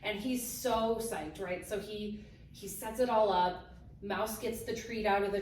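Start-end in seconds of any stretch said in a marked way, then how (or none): none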